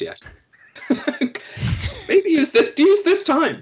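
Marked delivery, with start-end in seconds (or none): 0.9-3.6 laughing. Real, not acted. Laughing while advising player.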